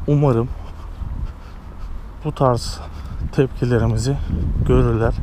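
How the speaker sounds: ordinary talk, nothing marked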